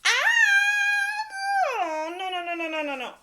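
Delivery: high wailing no's, fading down; distressed wail. Acted. Elephant upset about a bird on his head, wailing no. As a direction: cry out in dismay, calming step by step.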